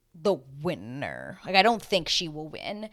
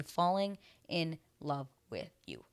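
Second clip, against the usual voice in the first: FALLING, IN, LOVE, WITH, YOU, each word set apart; slow word-by-word tally of "Falling in love with you"; counting out words; 5 dB quieter than usual. Real, not acted. Counts five words, one by one.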